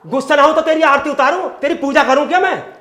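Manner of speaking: loud, high, fast sarcastic anger; angry. Acted. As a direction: throw it back furiously. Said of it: "क्यों गुस्सा हो रहे हो?".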